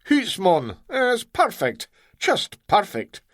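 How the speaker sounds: high, overdone Scots praise; hammed-up Scottish reassurance; Scottish accent. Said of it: "phony accent".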